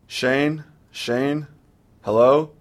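high, anxious calling out over the phone; panicked calling. A narrator's reading. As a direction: call out, scared he's gone. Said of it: "She panics".

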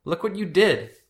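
high-pitched outraged cry; upset accusation. A narrator's reading. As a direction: blame him for the damage. Brother blames him for broken table.

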